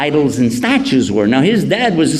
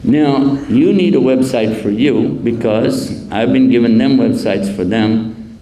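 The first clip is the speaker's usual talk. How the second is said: normal talking voice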